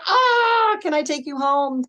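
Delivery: high, loud melting squeal into a question; gushing over cuteness. Retold or imitated. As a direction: imitate being smitten by adorable kids. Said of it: "And I'm just like".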